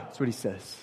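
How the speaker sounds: ordinary delivery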